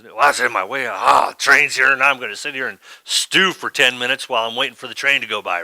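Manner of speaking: loud, grumpy complaining; exasperated mock grumble; grumbling stew. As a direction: grumble like you're stuck waiting. Grumbling self at the train.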